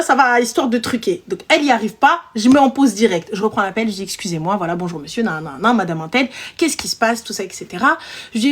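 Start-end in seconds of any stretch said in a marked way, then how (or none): 4.0-8.0 low, soft professional greeting; composed customer-service politeness, quickly sketched; polite phone voice. Retold or imitated. Agent takes over the angry caller's call, politely composed. Acting her own phone voice.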